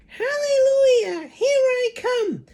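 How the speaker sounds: very high, slow, loud crowing crone; screechy old woman. Acted. Grandma crowing to George as she rises, screechy. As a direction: crow it like a giddy witch. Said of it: "she shouted, finding her voice".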